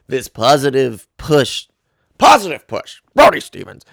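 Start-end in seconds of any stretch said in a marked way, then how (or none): 2.2-3.8 a lightly done, higher, louder Brody Stevens catchphrase; bad Brody Stevens impression. Podcaster tossing off his catchphrase as a bad impression, loud and high. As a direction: toss off a quick bad impression of the comic, punching the first words high. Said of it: "that was a bad Brody impression".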